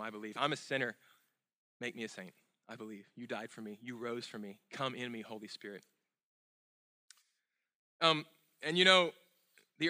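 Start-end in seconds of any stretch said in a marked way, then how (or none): 0.0-5.8 quiet prayer. Shown as a demonstration. Sinner's prayer voiced softly.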